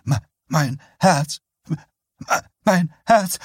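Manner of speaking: stammered, breathless, rising panic; clutching heart, gasping. Acted. Woman clutching her chest after the shock, stammering gasps. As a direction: gasp it out as if the heart fails. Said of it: "presste beide Hände auf ihren Monsterbusen".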